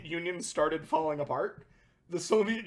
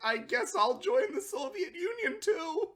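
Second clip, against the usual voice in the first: much higher, timid, hesitant; nebbish kid; 9 semitones higher, 4 dB quieter than usual. Retold or imitated. Pizza Hut as the nerdy kid timidly joining the Soviets, much higher. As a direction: shrink into an awkward nerdy kid. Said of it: "the weird Nebish kid".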